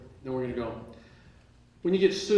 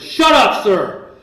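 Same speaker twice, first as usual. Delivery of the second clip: very high, very loud yell; imitated shout; 6 semitones higher, 13 dB louder, much slower than usual. Judge yelling at the defendant again, high loud shout. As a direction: scream it at him like the judge.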